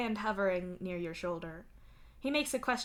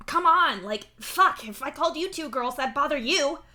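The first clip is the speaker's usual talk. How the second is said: high, loud, exasperated insistence; insisting; 8 semitones higher, 11 dB louder than usual. A narrator's reading. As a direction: push the point at a near shout. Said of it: "Mituna insists".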